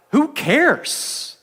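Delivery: high, exasperated who cares; impatient Jairus. Acted. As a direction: brush it off as the desperate father. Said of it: "And Jairus is like".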